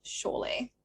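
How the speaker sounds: whispered echo of the word. Real, not acted. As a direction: breathe it out as a whisper.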